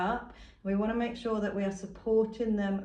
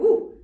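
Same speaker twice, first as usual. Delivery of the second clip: mock whoosh; 12 semitones higher, 14 dB louder than usual. Shown as a demonstration. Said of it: "we're not just gonna go".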